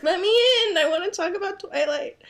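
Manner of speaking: a mock whine at the door, begging to join; begging to be let in. Locked out of the secret podcast, pleading to come in. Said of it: "slowly knocking on the door".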